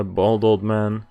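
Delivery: normal talking voice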